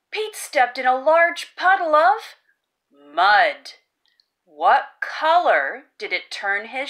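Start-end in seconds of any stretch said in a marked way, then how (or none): none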